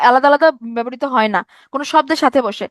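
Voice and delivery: ordinary delivery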